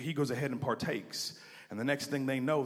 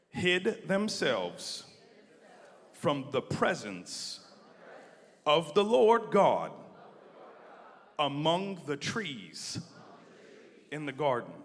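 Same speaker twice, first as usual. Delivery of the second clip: slow, each phrase set apart by pauses; slow phrased reading; slower than usual. Shown as a demonstration. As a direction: lead the congregation phrase by phrase. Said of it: "say this with me".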